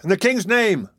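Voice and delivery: high, official-sounding call; crowd's cry. A narrator's reading. Crowd demanding entry from outside, crying out. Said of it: "cried the voices without".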